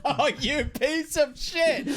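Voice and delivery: high, slow mock outrage; outraged high squeal. Real, not acted. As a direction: squeal it in disbelief. DM outraged at monster rules.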